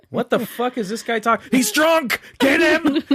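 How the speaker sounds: high, hammed-up cry of "He's drunk! Get him!"; mock alarmed shout. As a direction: yelp it like a panicked cop lunging. Imagined cops pouncing.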